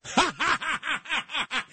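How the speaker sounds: long, staccato maniacal cackle; put-on madman's laughter; psycho laugh. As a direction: cap the mock rant with a high, rattling laugh. Maniacal laugh capping mock psycho rant.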